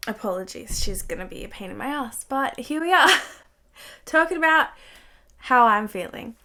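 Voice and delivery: normal talking voice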